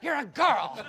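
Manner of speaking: put-on a boy in a panic, half laughing; eighth-grade boy objecting to the girl in his room, alarmed; terrified protest. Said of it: "So I was terrified".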